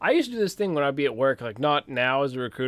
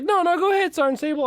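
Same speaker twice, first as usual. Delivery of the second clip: eager colonel. Retold or imitated. Colonel urging him on.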